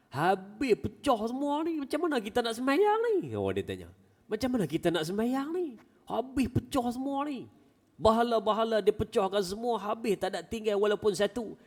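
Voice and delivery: high, fast complaining woman's voice; a high, hammed-up distressed wife; wife's lament. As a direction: wail to the husband about the broken idols. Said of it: "Mak Ummu Darda ni".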